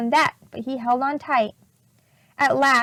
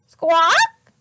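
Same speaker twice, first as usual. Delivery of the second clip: loud squawk in a strange voice; parrot squawk; 4 semitones higher, 6 dB louder than usual. A narrator's reading. Polly the parrot squawking above them. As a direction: screech like a parrot.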